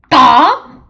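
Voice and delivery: loud, high rising squawk; crow caw. Acted. Crow flying up out of the way, loud caw.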